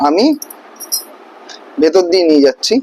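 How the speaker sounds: usual speaking voice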